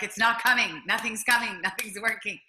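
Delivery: loud, exasperated outburst; frustrated retelling. Retold or imitated. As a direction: complain loudly, voice pushed up. Retelling her frustration with meditation.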